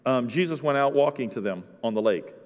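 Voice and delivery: ordinary delivery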